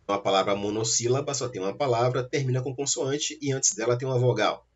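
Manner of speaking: normal talking voice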